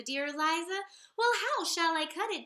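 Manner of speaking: ordinary delivery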